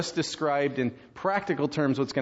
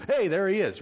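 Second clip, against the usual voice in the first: higher, sudden exclamation; mock surprised sighting; 6 semitones higher than usual. People spotting Jesus suddenly returning, mock surprise.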